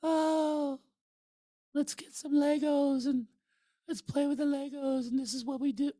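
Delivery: put-on very high, sing-song childish voice; squeaky kindergarten-teacher impression; impression of the friend. Mocking the ex-wife's friend, squeaky childish voice. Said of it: "watch, here's the impression".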